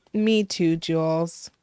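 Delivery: ordinary delivery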